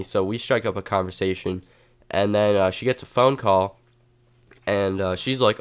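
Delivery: ordinary talk, nothing marked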